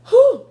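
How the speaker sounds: sharp, very high loud exhale whew; real whew from the spice. Real, not acted. Burning mouth while eating on camera, a loud high whew. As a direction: blow out the burn of the chili.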